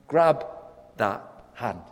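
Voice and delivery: an urgent call to reach out; punchy command. Shown as a demonstration. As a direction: snap out the first word. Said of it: "Let's do it like that".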